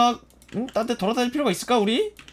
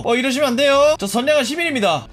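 high, raised voice, protesting innocence; mock pleading; 5 semitones higher, 4 dB louder than usual. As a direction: plead playfully with an angry mob.

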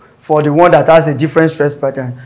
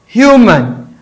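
human with HU raised and stressed, falling after; 5 semitones higher than usual. Shown as a demonstration. Teacher contrasting human's first-syllable stress. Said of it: "is having a different stress pattern".

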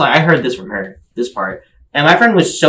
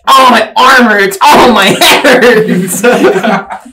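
slow, higher, louder laughing complaint; mock groan, laughing; 8 semitones higher, 7 dB louder, much slower than usual. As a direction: ham up the pain through laughter. Mock groaning about injuries, laughing.

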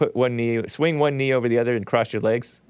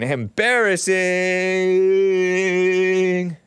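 a long sneering groan of a word; drawn-out mock disgust; 7 semitones higher, much slower than usual. Real, not acted. To listeners about the photo, drawn-out sneer. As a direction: stretch it out, sneering and high.

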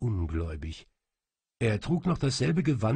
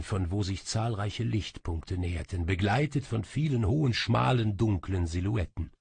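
plain reading voice

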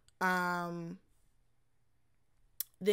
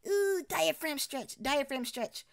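imitating vocal coach; 6 semitones higher, slower than usual. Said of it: "she'll always go like".

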